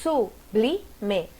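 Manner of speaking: sublime broken into su-bli-me with pauses; syllable by syllable. Shown as a demonstration. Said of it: "como separa".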